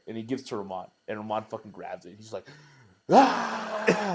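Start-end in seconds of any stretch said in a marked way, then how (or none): 3.1-4.2 high "Ah!" imitating a friend; imitated high yell. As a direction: blurt out a high mock yell. Imitates Ramon chugging.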